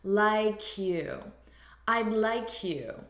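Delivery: "like you" said "lie-kyou", drawn out; linked sounds. Shown as a demonstration. Teacher showing the transferred sound, "like you" linked slowly. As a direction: slide the K in "like" over to start "you". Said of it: "transfer it on to the beginning".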